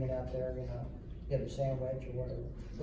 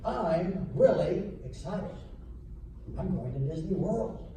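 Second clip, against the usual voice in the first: slow and higher, stretching out "really" with a lift at the end; excited phrasing; 4 semitones higher, slower than usual. Shown as a demonstration. Clinician showing band how phrasing adds emotion, slow and excited. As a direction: sing the sentence out like you can't wait. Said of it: "you can change that by saying".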